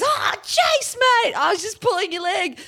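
very high, squeaky, sheepish voice; sheepish backpedal. Retold or imitated. Caught-out texter backpedalling to the host, high and sheepish. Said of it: "And he goes".

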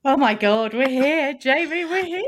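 loud, high, thrilled announcement; excited shouting. Real, not acted. To Jamie at the start, loud and thrilled. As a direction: burst out that you've finally arrived.

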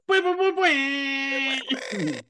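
high, drawn-out springy boings; imitated soundboard boing. Shown as a demonstration. Standing in for the podcast's missing soundboard, imitated boings. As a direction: voice a cartoon boing effect. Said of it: "that's where we need a soundboard".

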